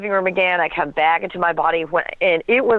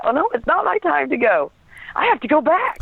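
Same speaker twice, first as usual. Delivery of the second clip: raised, urgent refusal to leave; pitched-up retold "no, I have to go back"; retold protest; 9 semitones higher than usual. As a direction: lift the voice high, insisting on going back. Retelling her refusal to go.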